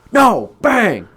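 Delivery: high loud no then bang; mock yell. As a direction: shout and mime the smash. Smashing with bat.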